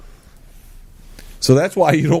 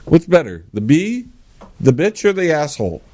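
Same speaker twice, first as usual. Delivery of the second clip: normal talking voice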